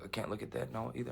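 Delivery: a hint of a muted other voice; hushed mock reply. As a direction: drop to a quiet retort for them. Quoting the controlling voice.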